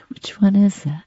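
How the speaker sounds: ordinary talk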